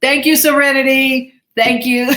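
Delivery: high, loud, beaming thank-you; excited thanks. Real, not acted. Thanking a viewer's message.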